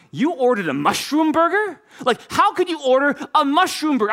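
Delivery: can't believe what he just heard; shocked cousin. Retold or imitated. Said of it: "most shocked look".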